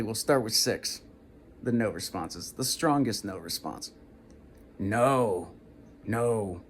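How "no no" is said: strong no. Shown as a demonstration. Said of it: "like strong no".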